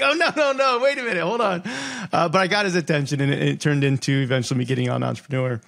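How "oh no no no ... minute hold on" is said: high, laughing mock backpedal; much higher, laughing through a retold plea; laughing panic. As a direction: scramble to take it back, laughing. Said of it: "I was like".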